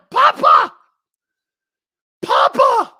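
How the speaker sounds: very high, loud falsetto cry; mock Fredo wail. Mocking Fredo as the Don is shot, shrieking falsetto wail.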